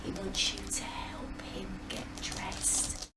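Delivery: soft secret whisper. Acted. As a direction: whisper it to the class. Teacher whispers to class.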